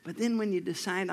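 normal talking voice

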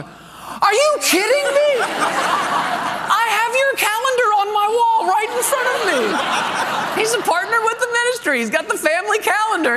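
high, excited caller voice, bubbling with laughter; stunned phone agent. Retold or imitated. Amazed phone agent tells pastor he has the ministry calendar, squealing with delight. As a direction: act the amazed man on the phone, pitched high.

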